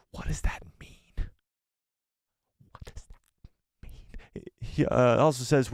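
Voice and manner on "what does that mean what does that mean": slow, hushed whisper; puzzled whisper. Pretend. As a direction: whisper it slowly, drawn out in mock bafflement. Puzzled by cryptic chat message.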